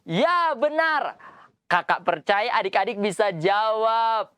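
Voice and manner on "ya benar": excited praise. Acted. Praising children's answer.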